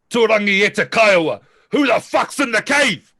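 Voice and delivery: high, full-throated war cry; battle yell. Acted. Player's warrior challenges whoever is in the cave, yelling a battle cry. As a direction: roar a challenge at the enemy, loud and high.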